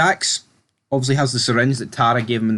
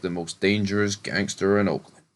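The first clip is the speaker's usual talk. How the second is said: mock ominous; 4 semitones lower, 6 dB quieter than usual. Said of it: "And he's like".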